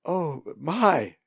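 high, loud exclamation of alarm; alarmed cry. A narrator's reading. Molly sees Colin keep falling, alarmed cry. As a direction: cry out as things go wrong. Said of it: "she cried".

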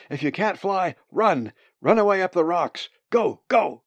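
loud, high, urgent commands; screamed. A narrator's reading. Bird's brain orders escape uphill, screamed. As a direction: drive them on with frantic shouts. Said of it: "screamed his brain".

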